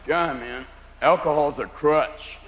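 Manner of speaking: low, slow stoner voice; deep, lazy smoker talk; stoner friend. Acted. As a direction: drag it out like a mellow pothead. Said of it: "your friends who smoke marijuana going".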